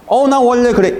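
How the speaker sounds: high, loud mock-defiant "that's just how I am"; stubborn retort. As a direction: toss it off like a proud know-it-all. Stubborn self-justifying person quoted.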